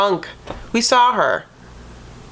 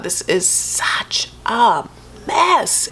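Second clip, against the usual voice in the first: breathy whisper, slow; whispered aside; 3 semitones higher, much slower than usual. Real, not acted. Host whispering to viewers about the mess, slow whisper. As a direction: whisper it like a shocked gossip. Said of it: "whispered aside".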